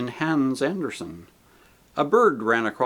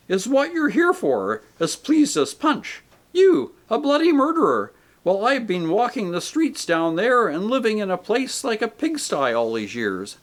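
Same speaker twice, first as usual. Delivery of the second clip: much higher, louder furious rant; outraged ghost; 9 semitones higher, 4 dB louder than usual. A narrator's reading. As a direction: spit out bloody murderer. Voices the big ghost.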